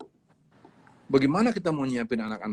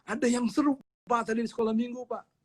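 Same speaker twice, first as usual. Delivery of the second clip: put-on much higher, eager kid calling Dad; excited child calling; 10 semitones higher, 3 dB louder than usual. Child calling his father after Sunday school, excited and high. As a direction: burst in bubbling with news. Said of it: "dia teriak-teriak ke bapaknya".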